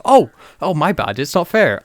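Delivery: loud, high mock surprise; mock apology. Doctor answering Bonnie's complaint about fairness, sarcastic. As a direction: feign sudden realisation, hammed up.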